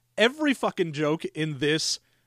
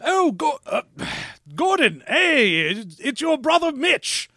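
effete brother Mitch; 7 semitones higher than usual. Retold or imitated. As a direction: greet Gordon like a prissy Niles type. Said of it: "he's like".

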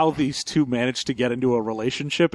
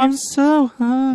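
squeaky whiny puppet; high, hungry little puppet; 10 semitones higher, 3 dB louder than usual. Pretend. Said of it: "in a high-pitched puppet voice".

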